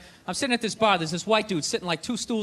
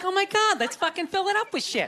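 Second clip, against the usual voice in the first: higher, gushing mock glee; mock girlfriend excitement; 5 semitones higher than usual. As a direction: play the eager girlfriend planning. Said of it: "They're like".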